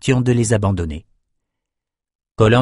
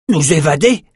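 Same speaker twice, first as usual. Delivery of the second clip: higher-pitched, rising cry of disbelief; astonished echo, pitched up; incredulous exclamation; 6 semitones higher, 4 dB louder than usual. A narrator's reading. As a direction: exclaim it as a startled question. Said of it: "s'écria Brock".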